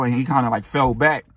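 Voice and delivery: ordinary talk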